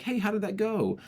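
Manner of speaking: high, gentle, inviting question; open inviting tone. Shown as a demonstration. Asking a friend how it went, open and inviting. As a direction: invite them to tell you everything. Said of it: "There's an openness to my vocal flow".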